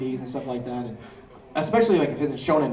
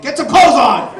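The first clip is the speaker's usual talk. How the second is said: higher, much louder, laughing scold; mock fatherly yell; 11 semitones higher, 14 dB louder than usual. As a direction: shout like a shocked dad. Scolding a teen cosplayer.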